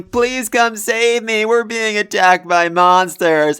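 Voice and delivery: hammed-up panicked whimper; mock crying plea. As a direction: imitate a cowardly knight wailing for rescue. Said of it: "he starts screaming and crying".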